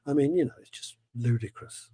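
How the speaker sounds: ordinary talk, nothing marked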